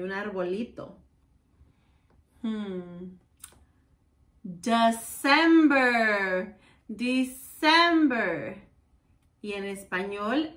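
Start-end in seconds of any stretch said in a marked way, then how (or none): 4.4-8.6 December slow and loud; slow loud model word. Shown as a demonstration. Teacher reveals December slowly and loudly to kids. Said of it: "models month slowly".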